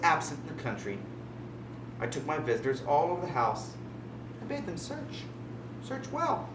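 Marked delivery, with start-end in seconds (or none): 4.4-6.5 mocking invitation. High soft mocking invitation.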